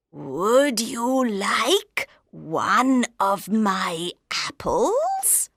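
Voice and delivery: very slow, rising crone offer; wheedling old lady. Acted. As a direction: tempt her sweetly.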